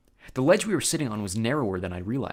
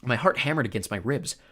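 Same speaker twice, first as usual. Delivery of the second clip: plain reading voice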